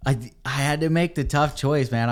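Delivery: normal talking voice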